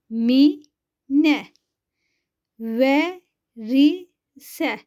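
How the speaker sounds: each syllable said alone with a pause after it. Shown as a demonstration. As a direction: spell out the word slowly for pupils to repeat. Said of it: "Ben okuyorum".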